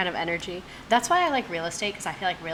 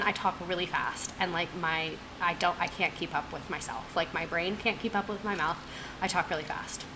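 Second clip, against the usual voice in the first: ordinary talk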